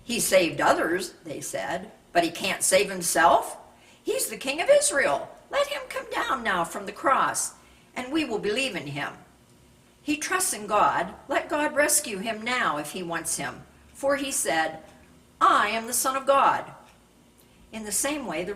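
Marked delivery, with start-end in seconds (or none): 0.0-1.1 loud scornful jeer; mocking. A narrator's reading. Elders mocking Jesus on the cross, loud scorn. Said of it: "and the elders mocked him". 2.1-16.7 loud, high scornful taunting; mocking. A narrator's reading. Chief priests mocking Jesus on the cross, loud higher jeer. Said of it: "and the elders mocked him".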